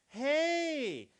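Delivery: bright hey swooping up high; hey with the pitch rising at the end. Shown as a demonstration. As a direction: greet an old friend, voice going up. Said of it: "that tonality that goes up".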